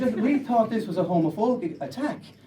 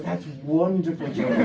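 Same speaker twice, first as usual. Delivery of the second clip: put-on fawning boyfriend; 3 semitones lower than usual.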